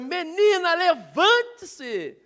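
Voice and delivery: high, loud, drawn-out shout; a big commanding yell. Acted. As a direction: bellow it like raising the dead. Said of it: "Dá um gritão".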